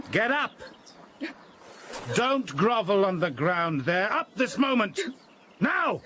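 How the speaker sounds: high-pitched, mounting fury; angry command. Acted. Father ordering his cowering son off the ground, angry. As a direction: order him up, temper rising with each phrase. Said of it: "I'm getting angry".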